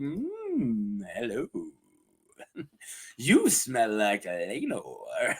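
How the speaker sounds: low, soft, slow seductive dragon; mock flirty. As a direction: purr it slowly and suggestively. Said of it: "He gives him a flirty look".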